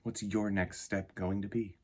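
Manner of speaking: soft, measured storybook reading; reading to children. Acted. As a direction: read it gently to a young audience, letting lines land. Reads picture book aloud.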